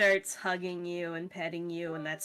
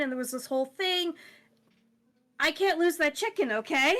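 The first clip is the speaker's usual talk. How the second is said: higher, stumbling, insistent plea; upset pet owner; 6 semitones higher than usual. Acted. Chicken owner arguing with caretaker, upset and pleading. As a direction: plead urgently, voice pitched up.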